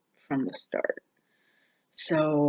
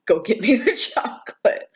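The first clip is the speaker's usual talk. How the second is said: laughing through a bossy, higher, louder demand; 6 semitones higher, 6 dB louder than usual. Retold or imitated. Quoting herself to her partner, laughing and demanding. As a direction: giggle while playfully demanding the treat.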